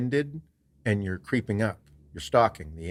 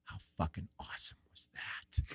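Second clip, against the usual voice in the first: awed whisper; 4 semitones lower, 14 dB quieter than usual. Retold or imitated. Hunting partner after the animals flee, imitated awed whisper. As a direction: whisper it with quiet relish. Partner's hushed reaction, retold.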